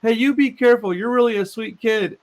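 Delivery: a hint of a worried mother; caring older lady. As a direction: play the concerned lady warning a kid. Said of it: "And she's like".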